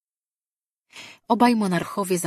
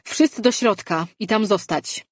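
loud, higher commanding call to the crowd; calling out orders; 5 semitones higher, 5 dB louder than usual. A narrator's reading. The high priest orders the crowd into the pavilion, a loud firm call. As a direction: order everyone inside with firm authority. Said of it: "zawołał wysoki kapłan Halet".

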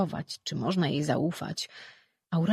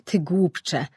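louder, dripping with scorn; contemptuous insult; 5 dB louder than usual. A narrator's reading. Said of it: "głosem aż ociekającym złością i pogardą".

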